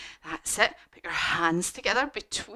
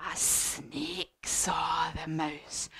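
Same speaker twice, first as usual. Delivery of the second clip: slow, low whispery narration; soft, drawn-out storybook menace; hushed suspense; 5 semitones lower, 7 dB quieter, much slower than usual. A narrator's reading. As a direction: creep in slowly to introduce a snake. Introduces the sly snake.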